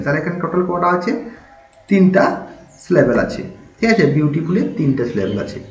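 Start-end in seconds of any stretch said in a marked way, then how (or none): none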